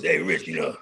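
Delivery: mafia guy. Retold or imitated. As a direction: drop way low like an old mob boss telling war stories. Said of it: "they start telling mafia stories".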